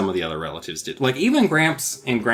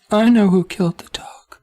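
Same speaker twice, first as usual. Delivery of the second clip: put-on creepy kid; 9 semitones higher, 5 dB louder than usual. Creepy joke voice for Nat.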